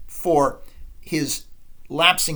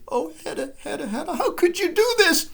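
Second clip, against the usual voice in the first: soft dismayed plea; 12 semitones higher than usual. Acted.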